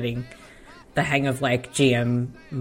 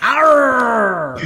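pirate growl; 8 semitones higher, 3 dB louder than usual. Pretend.

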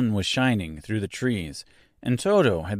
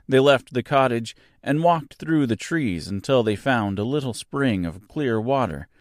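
ordinary delivery, nothing marked

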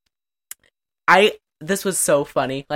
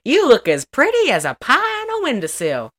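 mock country talk; 9 semitones higher than usual. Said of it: "'cause he's saying".

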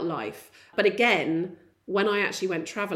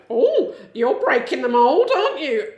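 slow, loud, higher-pitched mock admiration; patronising imitation; 5 semitones higher, 8 dB louder than usual. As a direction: draw it out like a condescending tutor. Mimics tutor's patronising reply.

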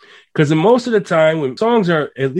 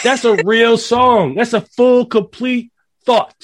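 passionate rant; 9 semitones higher than usual. Real, not acted. Ranting about modern songs.

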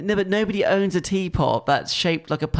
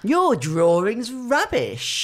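much higher, slow sneer; smug brush impression; 7 semitones higher, much slower than usual. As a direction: look down your nose, drawn out. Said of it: "that's a really good impression".

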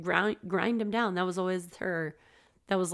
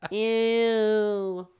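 disgusted ew; 2 semitones higher, 5 dB louder than usual. Real, not acted. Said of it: "I can make an ew sound".

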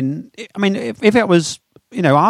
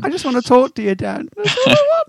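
high, swooning lovesick voice; giggly late night call; 11 semitones higher than usual. Pretend. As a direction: coo it like a smitten lover. Mock lovesick talk to Dan.

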